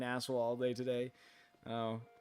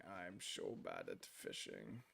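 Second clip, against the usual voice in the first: much lower, much softer, slow goofy rumble; silly deep voice; 15 dB quieter, slower than usual. Pretend. Streamer joking to chat about fishing, goofy low rumble. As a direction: drop into a comic low rumble.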